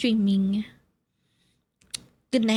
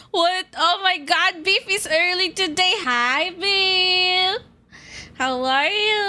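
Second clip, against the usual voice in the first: high, louder, delighted to see a viewer; light up at an early arrival; excited greeting; 8 semitones higher, 3 dB louder than usual. Real, not acted. Greeting a chatter who showed up early, high and excited.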